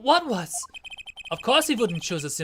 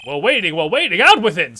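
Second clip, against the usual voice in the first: loud, demanding, building to a yell; impatient shout; 9 dB louder than usual. Acted. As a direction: push them to spit it out.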